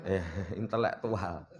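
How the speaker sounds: low, soft chuckle into the word; amused chuckle. Real, not acted. Preacher joking about so-called intellectuals, quietly chuckling. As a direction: chuckle quietly at your own pun. Chuckles at own wordplay on title.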